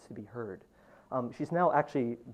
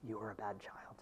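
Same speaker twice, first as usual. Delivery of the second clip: much softer, breathy whispered line; 4 semitones lower, 13 dB quieter than usual. Acted. Poet whispers one line of the poem to the audience. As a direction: drop suddenly to a whisper.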